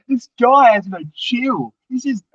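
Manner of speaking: mock disbelief at a teammate going all in; amazed inner thought. Thinking about a reckless rival, high disbelief.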